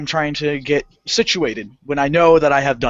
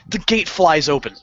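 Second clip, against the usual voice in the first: normal talking voice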